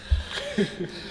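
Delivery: sudden high laugh; real laugh, short and high; high burst of laughter. Real, not acted. Laugh between tired questions.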